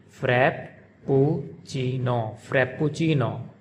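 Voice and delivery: frappuccino slowly, held double p, u as oo: frap-poo-chee-no; slow Italian frappuccino, lingering on the pp. Shown as a demonstration. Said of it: "they pronounce double P".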